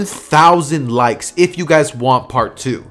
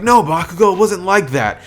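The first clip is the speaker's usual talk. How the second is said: higher-pitched, pleading denial; lightly acted anxious objection; Deku protesting; 6 semitones higher than usual. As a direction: protest your innocence in a raised pitch. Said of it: "Deku would look at him and be like".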